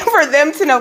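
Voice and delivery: a touch of emotion after a small laugh; getting emotional. Real, not acted. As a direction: hold back welling feeling as you repeat the prayer. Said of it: "I'm about to get emotional".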